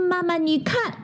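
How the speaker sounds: a cub's high soft voice; little cub voice. Acted. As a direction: play a small cub tugging at mum. Little bear speaks to mother.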